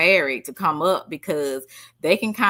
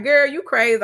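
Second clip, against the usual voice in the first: higher, louder mock retort to the woman; sassy retort; 6 semitones higher, 5 dB louder than usual. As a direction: tell her she's crazy, sassy and loud. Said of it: "I'm like".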